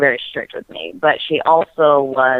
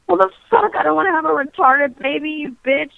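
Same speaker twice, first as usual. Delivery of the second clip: much higher, indignant and fast; outraged retort; 12 semitones higher than usual. Retold or imitated. Girl retells snapping at her friend's advice, high and outraged. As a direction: fire it back at the friend in disbelief.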